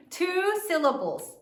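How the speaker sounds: ordinary talk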